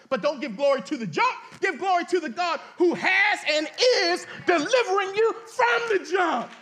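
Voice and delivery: loud, rising preacher's call; full-force preaching. Acted. Preacher urging the congregation to own their past, full-force preaching. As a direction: drive it home to the congregation.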